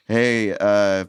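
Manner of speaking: usual speaking voice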